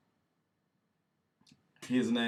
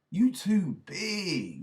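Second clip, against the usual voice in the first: scolding; 9 semitones higher, 6 dB louder, much slower than usual. Real, not acted. Scolding the wrestler.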